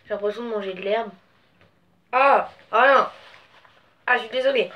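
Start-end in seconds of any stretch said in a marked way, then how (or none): 2.1-3.1 disgusted cry. Real, not acted. Tastes a bad bean.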